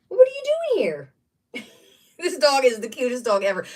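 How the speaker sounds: very high cooing question to the dog. Real, not acted. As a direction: squeal it at the pet. Talking to her dog.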